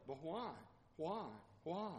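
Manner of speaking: lightly imitated pestering child, chirping each why; birdlike child's why. Three-year-old pestering a parent with birdlike questioning. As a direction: repeat it softly like a little bird call. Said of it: "Sounds like a bird".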